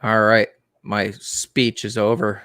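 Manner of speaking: ordinary delivery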